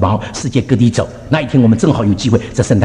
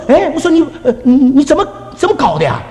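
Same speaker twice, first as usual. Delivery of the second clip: high, exasperated stammer dropping at the end; sputtering retold reproach; retold scolding; 11 semitones higher than usual. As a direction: scold your husband in mock disbelief.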